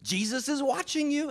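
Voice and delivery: high-pitched unseen voice; mysterious voice. Acted. Voice repeating its warning to a burglar, high-pitched. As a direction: lift it right up, a voice from the dark. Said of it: "He hears it again".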